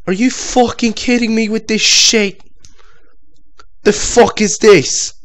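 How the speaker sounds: high, loud disbelieving outrage; high, loud indignant yelling; indignant outburst. Real, not acted. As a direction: rage at the absurdity. Outrage at game.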